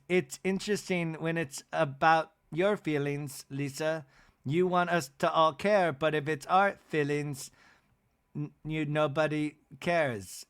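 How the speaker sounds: halting, measured mock complaint; put-on housewife voice, pausing between phrases; Whitney. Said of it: "Whitney goes".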